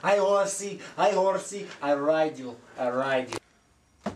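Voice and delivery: put-on slow, loud Russian accent joke. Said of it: "The Russian accent".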